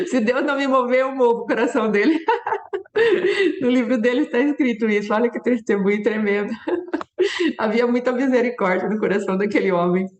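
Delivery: words broken up by real laughter; amused, chuckling through the quote; laughing while talking. Real, not acted. Preacher shares a bold line from his book, laughing.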